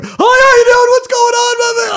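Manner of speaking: terrified fake-cheerful hello; panicked on-air greeting. Waterskier squeaking a hello to the anchor, terrified.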